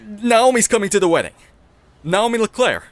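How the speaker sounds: loud, stunned, slowing; best man learning his ex is maid of honor, loud shock; shocked outburst. Acted. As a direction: blurt it out in disbelief. Learns ex is coming.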